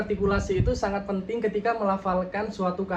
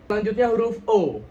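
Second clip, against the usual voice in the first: ordinary talk, nothing marked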